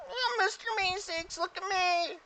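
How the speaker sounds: very high, slow creature voice; squeaky helper introducing itself; Mister Meeseeks. Acted. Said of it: "So the Meeseeks comes down".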